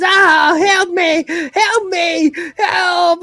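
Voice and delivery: high, loud mock cries for help; hammed-up panic. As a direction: squeal for help like a panicking cat. Cat voiced screaming for help.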